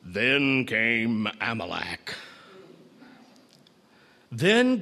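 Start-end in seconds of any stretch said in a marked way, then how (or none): 0.0-2.1 low, slow big-screen trailer announcer; ominous deep theater voice; deep movie-trailer voice. Acted. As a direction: drop it deep and draw it out like a cinema voiceover. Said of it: "with that deep voice saying".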